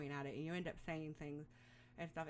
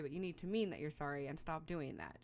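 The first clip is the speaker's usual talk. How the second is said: normal talking voice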